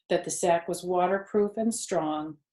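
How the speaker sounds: ordinary delivery, nothing marked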